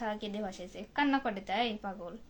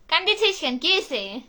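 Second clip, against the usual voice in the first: alarmed call; 4 semitones higher, 7 dB louder than usual. Real, not acted. Mother calling to her crying daughter, loud and alarmed. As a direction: call out in alarm: why are you crying.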